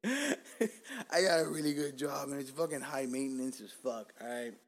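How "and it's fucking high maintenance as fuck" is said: ordinary talk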